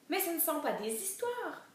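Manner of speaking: high-pitched indignant protest; protestation. A narrator's reading. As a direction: object forcefully as the little princess. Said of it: "protesta-t-elle". (protestation aiguë et énergique)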